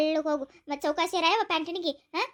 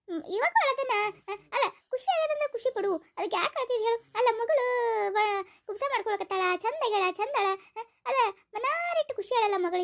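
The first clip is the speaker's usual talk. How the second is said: put-on teasing old aunt; 6 semitones higher than usual. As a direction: coax the bride out of tears. Aunt teases the crying bride.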